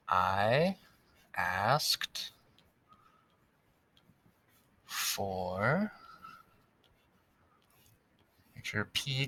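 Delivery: word by word, long pauses between; slow dictation. Shown as a demonstration. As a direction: dictate each word slowly while writing. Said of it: "writing while reading aloud".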